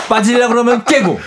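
high, loud laughing complaint. Real, not acted. Repeats laughing.

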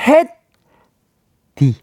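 햇띠 stretched very long and high; drawn-out reveal. Acted. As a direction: draw out 햇띠 like a game-show reveal. (정답 발표하듯 길게 끄는 햇띠)

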